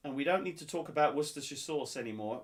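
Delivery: usual speaking voice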